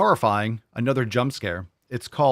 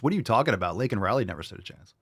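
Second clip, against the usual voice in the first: ordinary talk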